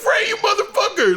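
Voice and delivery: high, laughing yell; mock shout. Kurt Russell greeting the dad, laughing mock yell. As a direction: shout it like a rowdy old pal.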